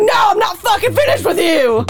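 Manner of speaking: high, heated imitation of an argument; acted-out high-pitched outburst; mock angry shout. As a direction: yell it in hammed-up exasperation. Retold angry refusal during the argument.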